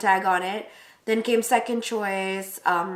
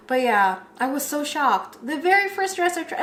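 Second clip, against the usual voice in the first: ordinary talk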